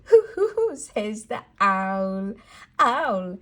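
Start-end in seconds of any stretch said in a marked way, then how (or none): 0.0-0.8 high loud hooting; playful high owl call for a child. Acted. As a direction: hoot like a cartoon owl.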